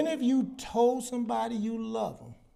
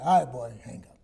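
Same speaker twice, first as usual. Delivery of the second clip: a lower, curt father's voice; gruff dad imitation; 7 semitones lower, faster than usual. Son imitates his father cutting the phone call short. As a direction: play the dad who's done with the call.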